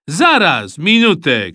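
loud, high call to halt; shouting. A narrator's reading. Boy interrupting the team line-up, shouting. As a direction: cry out to stop everyone. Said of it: "krzyknął Eusebiusz".

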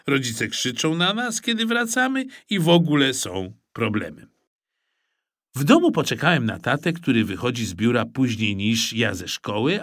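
plain reading voice

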